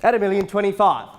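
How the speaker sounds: loud, rapid auctioneer calling; auctioneer calling bids. Acted. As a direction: rattle off the bids to the crowd. Live auction bidding calls.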